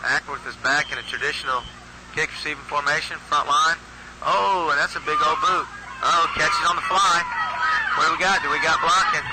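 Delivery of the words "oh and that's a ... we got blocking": excited shout of the returner's number; excited call. Real, not acted. Kick return unfolding live.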